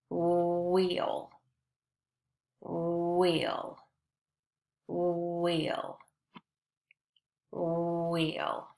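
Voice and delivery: "wheel" with a long oo-like start, monotone; stretched wh sound. Shown as a demonstration. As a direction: hold the "wh" of "wheel" before gliding on. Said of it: "They all have the, the woo sound".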